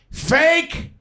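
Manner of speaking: a high, shrill-free mock yell of an old grandma. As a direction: belt it out from the back of the theater like grandma. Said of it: "at the top of her lungs goes".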